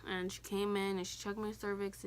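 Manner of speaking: ordinary talk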